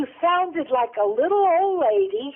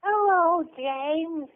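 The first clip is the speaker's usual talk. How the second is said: put-on creepy-cute little child greeting; grandma playing a small child; little kid voice. Shown as a demonstration. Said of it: "Do your best little kid voice".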